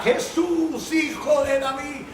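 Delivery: high pleading call to Jesus; desperate cry. Retold or imitated. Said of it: "él gritaba y gritaba". (clamor agudo y desesperado)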